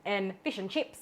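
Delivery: plain Aussie "fish and chips", clear i in fish and chips; Australian accent. Retold or imitated. Serving the Aussie viewers in her own Aussie accent. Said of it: "for all me Aussies".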